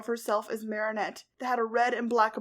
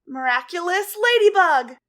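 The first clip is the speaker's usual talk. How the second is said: higher, loud ringing cry; triumphant call; 7 semitones higher, 14 dB louder than usual. A narrator's reading. Ladybug calling out her cure, loud and triumphant. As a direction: call the magic out proudly.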